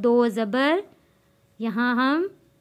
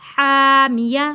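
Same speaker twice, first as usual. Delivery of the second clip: slow chanted हामी, vowel stretched; Quranic recitation; 5 dB louder, slower than usual. Shown as a demonstration. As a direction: recite हामी slowly in tajweed style. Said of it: "या ज़बर या".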